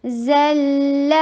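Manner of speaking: زَلَّ drawn out, lام held long; held doubled lam. Shown as a demonstration. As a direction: hold the لّ in زَلَّ. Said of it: "لام کے اوپر بینیا کا time سب سے میکس دیجیے". (زَلَّ میں لام کو لمبا کھینچیں)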